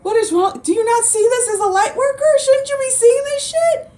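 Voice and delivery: mock exasperation. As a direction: act baffled at their panic, pitch way up. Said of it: "And I'm like".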